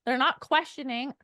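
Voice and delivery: usual speaking voice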